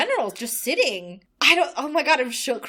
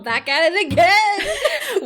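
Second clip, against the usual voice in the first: laughing; 8 semitones higher, 6 dB louder, much slower than usual. Real, not acted. Laughing podcast reopening.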